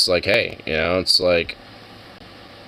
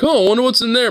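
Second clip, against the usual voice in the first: high, curious little bunny voice; squeaky, wondering bunny peeking in; bunny's thoughts; 14 semitones higher, 4 dB louder, faster than usual. Retold or imitated. Bunny wondering about the trash bin, high curious voice. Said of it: "It's like".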